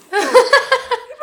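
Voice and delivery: squealing laugh, high and loud; helpless high laugh; high burst of laughter. Real, not acted. Laughing at her man's voice.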